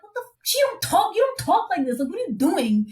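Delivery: hammed-up disbelief; imagined reader's shock. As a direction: play a baffled stranger reading it. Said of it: "they're gonna be like".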